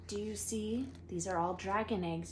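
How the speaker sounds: ordinary delivery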